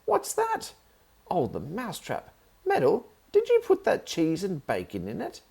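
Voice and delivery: very high startled yelp then scolding; high loud shocked aunt; startled pain. Acted. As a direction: caught by the trap. Trap nips aunt's toe.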